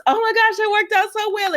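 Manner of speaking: high, giddy oh my gosh; mock gushing. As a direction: squeal it like a thrilled schemer. Brooklyn gushing after the scheme.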